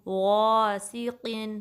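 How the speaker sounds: غَاسِقٍ very slow, long heavy first syllable; loud full gh in غَاسِقٍ, each syllable drawn out; heavy trapped ghain. Shown as a demonstration. Teacher demonstrating the word, slow heavy غَاسِقٍ. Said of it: "آواز کو trap کرنا ہے".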